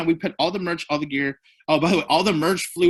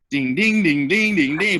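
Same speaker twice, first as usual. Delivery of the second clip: sung out higher and louder; imitated guitar plinks; 3 semitones higher, 5 dB louder than usual. Storyteller mimicking the song on the acoustic, plinking. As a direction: pluck each ding like a guitar string.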